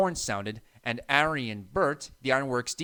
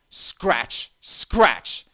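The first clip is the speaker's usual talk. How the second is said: scratching noise; 6 dB louder than usual. A narrator's reading. Strange noise under shed.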